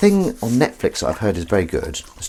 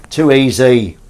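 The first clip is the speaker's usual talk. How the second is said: louder, smug repeat; smug loud brag; 4 semitones higher, 9 dB louder, slower than usual. Real, not acted. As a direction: crow it louder.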